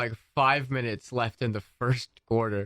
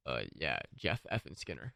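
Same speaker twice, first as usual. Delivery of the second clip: cool guy voice; 14 semitones lower, 15 dB quieter than usual. Retold or imitated. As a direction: act unimpressed and cool. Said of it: "trying to sound like a D-bag".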